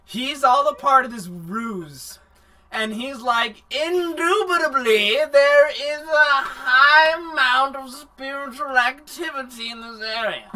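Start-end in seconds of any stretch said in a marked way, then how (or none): 3.7-10.5 posh mock British accent, high and loud; exaggerated British accent, pitched up. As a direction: ham up a British butler. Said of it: "'Cause he's clearly British".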